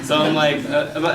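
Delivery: usual speaking voice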